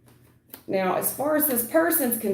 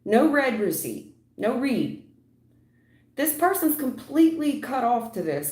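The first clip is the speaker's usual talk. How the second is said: ordinary delivery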